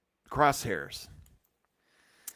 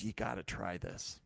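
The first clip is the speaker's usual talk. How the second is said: much softer, hushed coaxing; coaxing brewer; 4 semitones lower, 13 dB quieter than usual. Retold or imitated. Brewer offering his new beer, hushed coaxing. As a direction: lean in and urge quietly. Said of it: "he goes".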